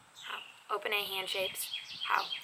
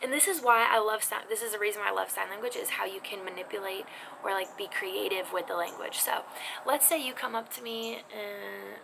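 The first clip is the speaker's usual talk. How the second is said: ordinary talk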